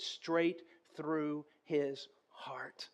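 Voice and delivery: dramatic whisper. Real, not acted. Dramatic climax.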